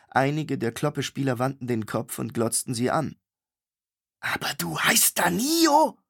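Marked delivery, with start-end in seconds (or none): 4.2-6.0 hissed. A narrator's reading. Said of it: "zischte sie".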